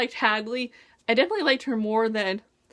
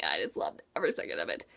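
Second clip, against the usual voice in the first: whisper; 8 dB quieter, faster than usual. Real, not acted. Gushing aside after loving the book.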